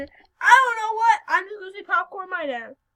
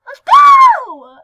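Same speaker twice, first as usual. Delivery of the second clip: very loud, high joking cheer; mock hype shout; 13 semitones higher, 21 dB louder than usual. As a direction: fake hype yell then drop it. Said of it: "I'm joking".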